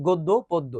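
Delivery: "গদ্য পদ্য" as goddo poddo; closed o demonstration. Shown as a demonstration. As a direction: round o in both words of "গদ্য পদ্য". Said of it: "দেখছো গ ও".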